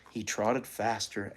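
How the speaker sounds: usual speaking voice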